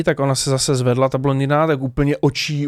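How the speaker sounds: ordinary delivery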